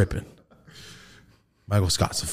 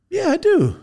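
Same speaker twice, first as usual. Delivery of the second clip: high bashful reply; 3 dB louder than usual. Retold or imitated.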